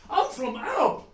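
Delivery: loud, high, laughing mock outrage; hammed-up fury of the insulted wife; outraged wife. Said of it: "she was pissed".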